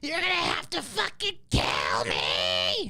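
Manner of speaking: furious scream; shouting. Acted. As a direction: scream it with rage. Played movie-style outburst.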